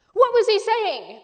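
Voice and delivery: breathless demand. A narrator's reading. Children demanding an answer from Mary Poppins, breathless. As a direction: ask breathlessly, dying to know. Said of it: "demanded breathlessly".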